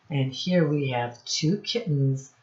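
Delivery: ordinary talk, nothing marked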